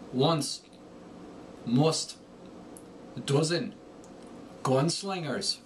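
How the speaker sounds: Irish accent, U rounded like look; Irish accent word drills; Irish U vowel as in look; Irish short U. Shown as a demonstration. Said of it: "The short U turns to U, as in look".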